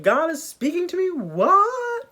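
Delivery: high, incredulous squeal; can't believe God is talking; amazed disbelief. Acted. Moses realizing God speaks to him, high disbelief.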